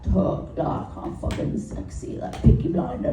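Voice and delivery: unvoiced-sounding, husky mock northern English; low-voiced northern English hard man; northern English accent. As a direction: put on a tough Peaky Blinders northern English accent. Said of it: "from the British northern towns where they're like".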